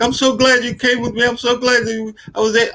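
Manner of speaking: high, gushing and delighted; happy cousin. Retold or imitated. Cousin thanking him after the shop, high and gushing. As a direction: gush like an overjoyed cousin. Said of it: "She's like".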